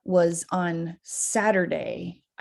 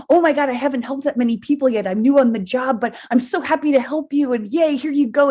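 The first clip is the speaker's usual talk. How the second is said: fast, higher, eager helper voice; bubbly clerk; 6 semitones higher, 4 dB louder than usual. Retold or imitated. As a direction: gush like a thrilled new worker. Voicing the cheerful new clerk.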